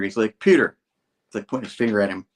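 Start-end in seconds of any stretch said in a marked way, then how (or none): 0.4-0.8 raised, loud call of the name in a retelling; mock confrontation, voice jumping up; confronting. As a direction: act out confronting a friend by name. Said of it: "Harry's confronting Peter".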